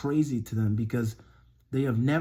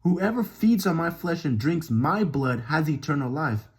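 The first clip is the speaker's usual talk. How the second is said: animated, emphatic Bible reading; scripture reading; 4 semitones higher, 3 dB louder than usual. Acted. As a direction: proclaim the verses, punching key words. Reads scripture aloud.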